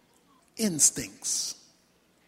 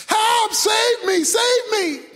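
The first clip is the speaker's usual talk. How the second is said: high-pitched shouts to be rescued; man yelling up for help; screamed plea; 8 semitones higher, slower than usual. Acted. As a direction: cry out urgently to be saved. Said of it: "and he screamed".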